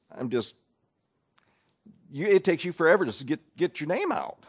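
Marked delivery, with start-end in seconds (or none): none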